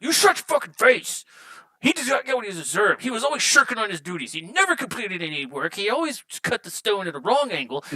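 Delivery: angry dwarf lays into his crying companion. Acted. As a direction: bark out a list of grievances. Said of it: "the angry one, the angry one's like".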